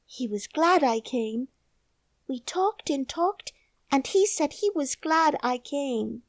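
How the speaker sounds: put-on solemn little girl; young girl. A narrator's reading. Mary's girl voice.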